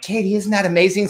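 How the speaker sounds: high thrilled gush; excited to wife. Retold or imitated. Telling his wife about the invite, high and thrilled. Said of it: "and I'm like".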